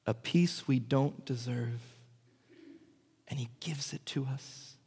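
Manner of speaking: soft, slow and reverent; softly marvelling at his mercy; tender. Real, not acted. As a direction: hush it down with gentleness. Tender words on gentle Jesus.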